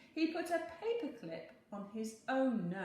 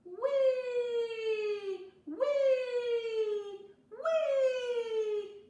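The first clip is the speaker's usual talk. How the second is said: loud high squeaks; a shrill little noise in the night; long high wee sounds, much louder; high squeaky wee; 12 dB louder, much slower than usual. A narrator's reading. Mouse hears a squeaky noise.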